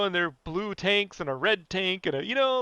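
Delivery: ordinary delivery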